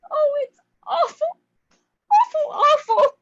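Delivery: high, loud despairing wail; anguished cry. Acted. Irina breaks down in despair.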